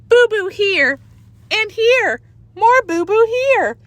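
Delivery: put-on high childish sing-song; toddler voice. A narrator's reading. Toddler Fudge shows his brother his scrapes, high childish voice. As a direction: show the boo-boos like a small child.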